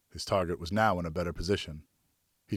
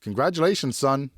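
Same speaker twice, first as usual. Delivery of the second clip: higher, louder triumphant call; triumphant yell; 6 semitones higher, 4 dB louder than usual. A narrator's reading. Father yelling congratulations to his son, higher and louder. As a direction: cheer the boy's first kill. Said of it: "I yelled triumphantly".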